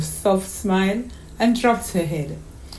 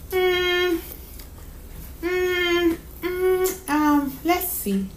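much higher, drawn-out hums; thinking little girl; 10 semitones higher, much slower than usual. Acted. Daughter choosing her questions for mom, curious. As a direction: hum while puzzling it out.

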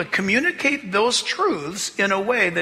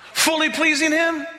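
ordinary talk, nothing marked

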